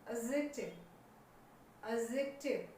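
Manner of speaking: "एडजेक्टिव" said a-zek-tiv, no D; silent D. Shown as a demonstration. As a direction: leave the D out of "एडजेक्टिव". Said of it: "नहीं बोलना है". ("एडजेक्टिव" में डी नहीं बोलना)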